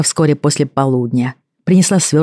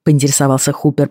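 ordinary delivery

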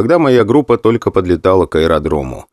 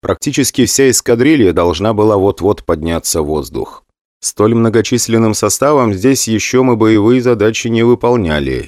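ordinary delivery

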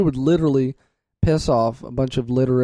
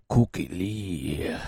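scratchy smoker radio persona; put-on radio alter ego; Cookie Lee; 6 semitones lower, 7 dB quieter, much slower than usual. Shown as a demonstration. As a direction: slip back into the smoky host voice. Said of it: "I have to do it like".

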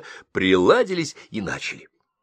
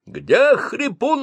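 much higher, loud and slow yelling; 6 dB louder, much slower than usual. Acted. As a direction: bellow the question over the crowd. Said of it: "орал старшина".

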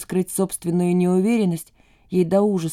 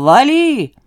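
high, loud holler; shouted call; 6 semitones higher, 6 dB louder than usual. A narrator's reading. Cheerful driver flinging the car door open, shouting. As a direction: call it out with a rise and fall. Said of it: "крикнул".

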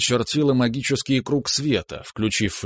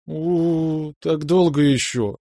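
whiny little sister; 6 semitones higher, much slower than usual. A narrator's reading. Nastya groans at long wait.

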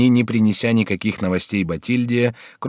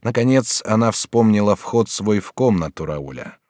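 plain reading voice

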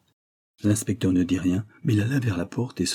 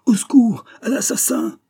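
very high, near-howled scream; desperate cry for help; 6 dB louder than usual. Acted. Holmes being attacked, almost howling for help. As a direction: scream for your life, voice giving way on the second cry. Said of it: "presque un hurlement".

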